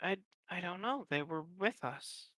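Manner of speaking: very soft, halting recollection; hushed, uncertain. Acted. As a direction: trail down to almost a whisper. Elf recounts losing missing companions.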